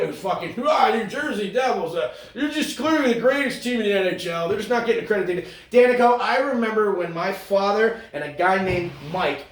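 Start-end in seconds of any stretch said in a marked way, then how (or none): 0.7-5.9 loud, high hype-man announcer voice; over-the-top booth announcer; mock gushing announcer. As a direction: gush like a homer broadcaster.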